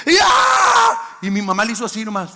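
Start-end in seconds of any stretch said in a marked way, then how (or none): 0.2-1.2 high loud mock scream; aunt's scream imitated in a story; imitated wail. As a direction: belt a shrill mock wail. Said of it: "estaba gritando feo".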